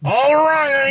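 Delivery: high-pitched mock Louisiana gator hunter; bad Louisiana accent. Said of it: "I can't do a Louisiana accent".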